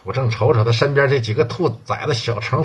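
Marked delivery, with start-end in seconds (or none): none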